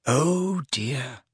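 dismayed, soft and falling; stricken. A narrator's reading. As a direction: sound suddenly hurt. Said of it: "looking stricken".

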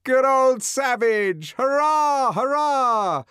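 loud, high, drawn-out cheering; jeering crowd cheer. A narrator's reading. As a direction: cheer like a rowdy mob of spectators. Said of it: "burst of laughter and hand-clapping".

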